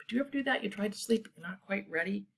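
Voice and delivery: usual speaking voice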